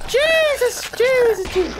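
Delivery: high, loud yelps of alarm; startled panic. Real, not acted. Player jumped by worms from the ground, panicked. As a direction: cry out in sudden fright.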